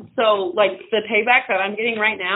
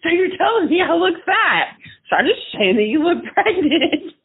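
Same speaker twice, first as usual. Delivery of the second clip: indignant retort; 9 semitones higher than usual. Retold or imitated. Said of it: "I said".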